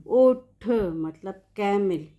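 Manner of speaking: ओठ spoken slowly with a clear long ओ; slow vowel demo. Shown as a demonstration. Teacher showing the पेश mark on अठ, ओठ slow and clear. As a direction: draw out the ओ in ओठ. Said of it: "पेश लगाएंगे".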